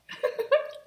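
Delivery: high amused giggle. Real, not acted. Laughs at own gum chewing.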